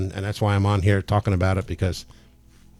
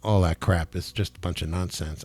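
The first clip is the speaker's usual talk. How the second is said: quiet defiance; 7 dB quieter than usual. Real, not acted. Healer telling demons he is not afraid, quiet defiance. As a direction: warn them off without raising your voice.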